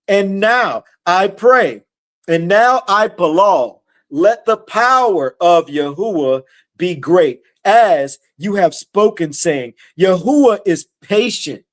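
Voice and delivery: measured preacher reading from the Bible; scripture reading. Acted. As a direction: read the verse out deliberately, stretching key words. Reads scripture aloud.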